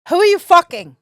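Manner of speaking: loud, high accusing shout; mock jealous outburst; ex's jealous yell. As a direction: hurl the accusation at him. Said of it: "she goes".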